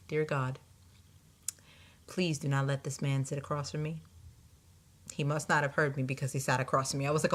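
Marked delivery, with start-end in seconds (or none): 0.1-4.0 silent prayer. Retold or imitated. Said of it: "in my mind, I'm thinking".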